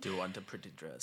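soft, fast, breathy devil whisper; a hushed devil's whisper offering a pretty dress; whispery devil voice. Retold or imitated. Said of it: "in a very whispery voice".

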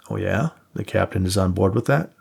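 usual speaking voice

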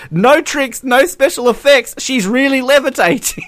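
high, amazed commentator echo; high-pitched mock amazement at a levitating jumper; mock commentator awe. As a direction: squeal it like a gushing high jump commentator. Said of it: "literally says".

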